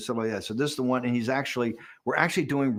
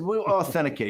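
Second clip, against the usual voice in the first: mock big shot; 7 semitones higher than usual. Strategist mocking his own swagger to the reporter, hammed-up big shot. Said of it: "I'm, I'm like this big shot".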